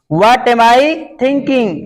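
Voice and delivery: each word slow and spaced; teacher spelling out a sentence; slow dictation. Shown as a demonstration. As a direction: dictate it slowly for the class. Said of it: "slow dictation of English".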